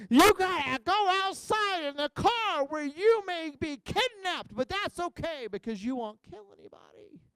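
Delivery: high, drawn-out exaggerated order; hammed-up high-pitched dramatic telling-off; mock scolding. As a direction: imitate a bossy scold, slow and high. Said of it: "Julie said".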